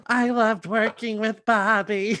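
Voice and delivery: high, slow, trembling near-tears voice; Gwen Verdon impression. Host imitating Gwen Verdon, high teary slow. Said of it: "always sounds like she's about to cry".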